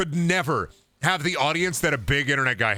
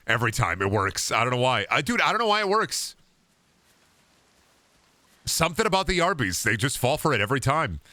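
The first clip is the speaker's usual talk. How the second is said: ordinary delivery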